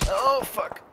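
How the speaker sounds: high startled exclamation; sudden alarm under fire; startled cry. Real, not acted. Ambushed again.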